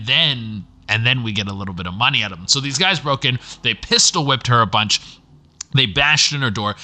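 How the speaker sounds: ordinary talk, nothing marked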